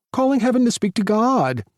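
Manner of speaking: sassy Tyler pranking a prayer call; animated sassy phone call. A narrator's reading. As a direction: ham up a pretend call to heaven.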